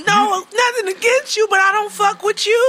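mocking herself at work; mock aside. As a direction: brush them off with a laugh. Imitated aside to coworkers.

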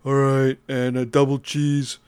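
lightly put-on customer voice, drawn out; pizza customer. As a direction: slowly add to a mock pizza order. Acting a customer ordering pizza.

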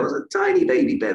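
put-on tiny baby bear. Shown as a demonstration. Presenter demoing bedtime story voices for children, high baby bear. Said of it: "you read a bedtime story to a small child".

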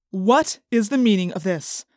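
stern demand. A narrator's reading. Assistant principal confronting three students, stern demand. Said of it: "he demanded".

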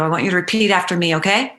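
normal talking voice

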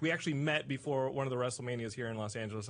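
ordinary delivery

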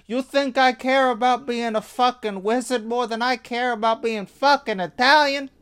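high, loud hammed-up wiseguy retort; offended Italian guy. Italian wizard rejecting Grindelwald's offer, high indignant wiseguy retort. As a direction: fire it back like a proud wiseguy. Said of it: "and he's like".

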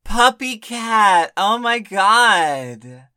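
high, loud and drawn out; excited. Real, not acted. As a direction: gush over it slowly. Excited reaction to topic.

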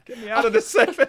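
mock pleading. Begging to escape awkward topic.

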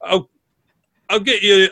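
bustling tavern keeper; innkeeper voice. Acted. Innkeeper offering a drink.

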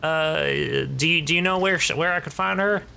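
put-on high, polite Southern teen voice; a nervous youngster with a Southern accent; basic Southern accent. Teen messenger asks for Leslie in a Southern accent. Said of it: "a little basic Southern accent".

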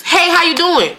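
high imitation of a Southern hello; lilting Southern-style greeting. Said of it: "It means".

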